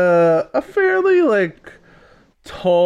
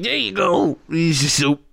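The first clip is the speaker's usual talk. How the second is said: dwarf Oleg. Acted. Dwarf cook serving soup, lightly voiced and fading. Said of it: "he's gonna be like".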